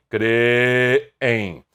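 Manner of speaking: Creem drawn out as cre-em; teacher dividing Creem; syllable split. Shown as a demonstration. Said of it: "separação silábica de creem".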